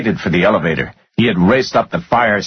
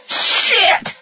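high, breath-heavy exclamation; panting curse. A narrator's reading. Finance guy horrified by the news, panting curse. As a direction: let the curse burst out on the breath.

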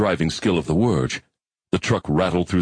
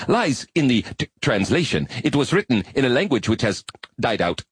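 higher, earnest insect-like alien voice; high alien arachnid; 5 semitones higher than usual. Acted. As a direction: play an eager, bookish spider creature.

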